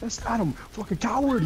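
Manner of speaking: high, exasperated yell; angry. Real, not acted. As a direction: call him out, fed up. Yelling at a teammate.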